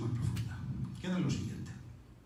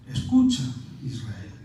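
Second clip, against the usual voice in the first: "Escucha" alto y fuerte, Israel más bajo; llamada solemne; 4 semitones lower, 6 dB louder, slower than usual. Pretend. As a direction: clama como un profeta. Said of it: "que significa". (exclamación fuerte y solemne)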